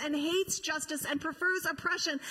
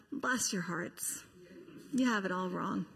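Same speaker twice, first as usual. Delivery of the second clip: sighing, gentle; 7 semitones lower, 4 dB quieter than usual. Retold or imitated. Jesus to two disappointed disciples, soft and pitying. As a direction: bless them gently, almost under the breath. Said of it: "Jesus just sighs and says".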